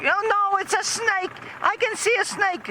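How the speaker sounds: very high-pitched screeching wife; imitated hysterical mother, pitched sky-high; mock panicked scream. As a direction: shriek it in falsetto, hammed-up terror. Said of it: "or the mother, and she said".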